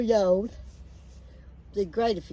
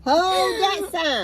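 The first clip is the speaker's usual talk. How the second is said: much higher, louder mock crying; mock wail; 10 semitones higher, 4 dB louder, slower than usual. Real, not acted. Laughing at holding up sign.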